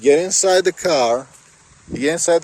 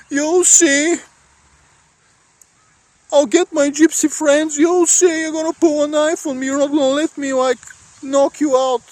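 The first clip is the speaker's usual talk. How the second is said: much higher mock threats; grudge-holding thug; 13 semitones higher than usual. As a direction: taunt him with revenge. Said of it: "they're like".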